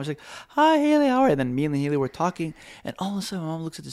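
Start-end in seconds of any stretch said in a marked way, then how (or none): 0.5-1.3 high, sweet imitation of mom; mock mom voice; mom's voice. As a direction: pitch way up for mom's greeting. Mom voiced greeting girlfriend.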